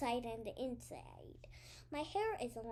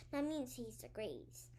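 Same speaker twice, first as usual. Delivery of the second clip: plain reading voice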